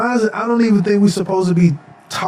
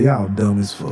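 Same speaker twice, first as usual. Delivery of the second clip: low, unimpressed put-down; mock disdain; 9 semitones lower than usual. Ancient visitors judging modern people, mock disdain. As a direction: drop low for a dry judgment.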